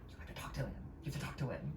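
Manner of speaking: hushed aside, barely voiced; imitated whisper. Assistant urging star to talk to TikToker, imitated whisper. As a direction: pretend to whisper discreetly to someone beside you. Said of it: "whispering something to the assistant".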